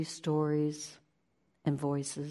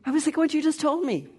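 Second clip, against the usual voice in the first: mock whiny retort; 10 semitones higher, 4 dB louder, faster than usual. Inner voice talking back.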